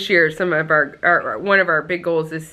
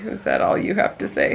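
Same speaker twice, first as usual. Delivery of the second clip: whisper; 14 semitones lower, 3 dB quieter than usual. Acted. Teasing whisper to partner.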